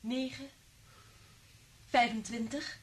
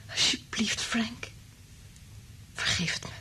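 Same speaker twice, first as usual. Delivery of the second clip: whispered plea; 2 semitones higher, 9 dB quieter than usual. Acted. Liz begs Frank's forgiveness.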